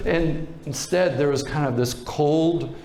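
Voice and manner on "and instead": ordinary talk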